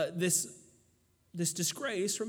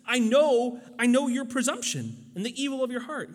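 loud accusing voice, higher; angry older brother; 5 semitones higher, 6 dB louder than usual. Retold or imitated. Eliab scolding his little brother David, loud and angry. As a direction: throw the accusation at him hard. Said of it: "he says".

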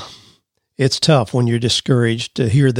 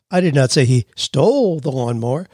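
"stole" raised and stretched out; stress on STOLE. Shown as a demonstration. Host shows emphasis changes meaning, stress on "stole". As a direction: punch the word "stole" after a tiny break. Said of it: "change the meaning dramatically just by your emphasis".

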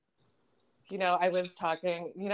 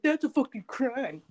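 mocking commenter voice; 6 semitones higher, slower than usual. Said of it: "people in the comments, like".

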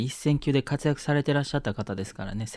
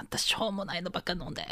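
high-pitched, softer mock auntie scolding; scolding Kansai auntie; 7 semitones higher, 7 dB quieter, faster than usual. Auntie chiding a friend back from the drink bar, high mock scolding. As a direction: imitate an auntie telling off her pal, pitched up. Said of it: "爆発しがちなおばちゃん集団".

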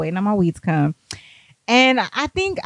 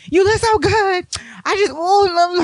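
much higher, drawn-out gushing yell; high-pitched hammed-up scream of a compliment; mock screaming; 11 semitones higher, 3 dB louder, slower than usual. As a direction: imitate an over-eager admirer yelling. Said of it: "Jordan is over here screaming".

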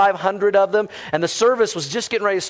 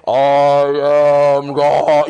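low, booming, very drawn-out voice; God; 5 semitones lower, 6 dB louder, much slower than usual. Retold or imitated. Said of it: "what the voice of God sounds like".

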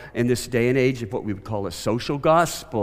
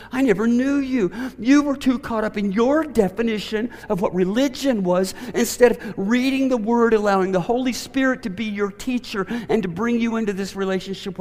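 high, loud pleading lament; preacher's second take, full of sadness; sorrowful Jesus; 12 semitones higher, 6 dB louder than usual. Shown as a demonstration. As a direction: cry it out with grief and longing. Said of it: "there'd be more sadness in it".

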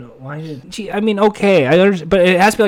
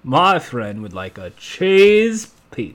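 put-on Southern accent; 2 semitones lower, slower than usual. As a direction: drawl it like a Southern man. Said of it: "that's a Southern man".

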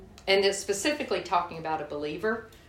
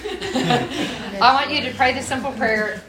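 slow, higher, laughing through the words; 6 semitones higher, 7 dB louder, slower than usual. Real, not acted. Repeating the prayer instruction to the congregation, laughing. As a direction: let real laughter carry the repeat.

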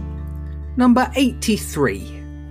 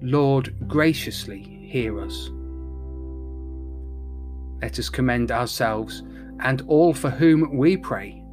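prayer; 4 semitones lower, 7 dB quieter than usual. Acted. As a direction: lead the prayers gently. Leads intercessions in prayer.

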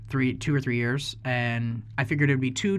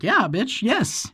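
emphatic yes; 8 semitones higher, 4 dB louder, much slower than usual. Retold or imitated. Telling him he pays.

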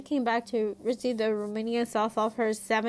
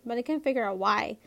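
ordinary delivery, nothing marked

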